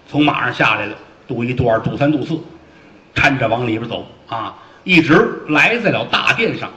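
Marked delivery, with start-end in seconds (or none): none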